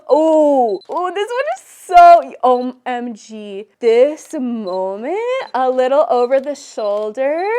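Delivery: loud, slow, gushing praise. Real, not acted. Photographer gushing over her model's pose, loud and slow. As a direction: swoon over the shot, drawn out.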